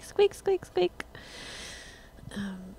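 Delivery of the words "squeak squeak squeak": high squeaky chair sounds; squeaking chair noise. Retold or imitated. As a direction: squeak squeak like a creaky stool. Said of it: "I'm like".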